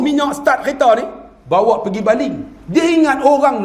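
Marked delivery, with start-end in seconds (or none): none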